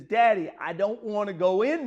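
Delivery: high, loud, pleading wail; crying child. Retold or imitated. Scared little daughter begging her daddy, crying loudly. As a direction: beg through tears like a scared little girl. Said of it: "and she was crying".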